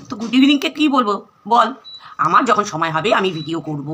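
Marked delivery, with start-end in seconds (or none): none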